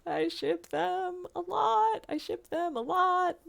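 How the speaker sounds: high squeaky gushing; squealing fangirl. Real, not acted. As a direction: gush like a fangirl. Fangirling over the characters.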